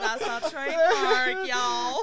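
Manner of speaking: high, sung-out intro line; hammed-up intro catchphrase. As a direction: belt the catchphrase like a show jingle. Podcast intro jingle voices.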